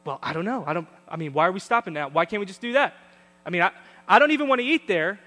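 fast, loud irritated complaining; snapping like a cranky relative; family bickering. Retold or imitated. Family snapping at each other on trip, mock irritated rant. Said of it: "the way we operate".

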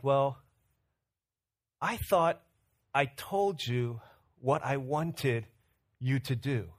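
slow, soft, pointed reproach; imitating the wife dragging out a disappointed rebuke; a hint of the hurt wife, slow; let-down wife.

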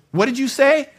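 stunned, demanding to know; shocked question. Retold or imitated. Shocked by friend's words.

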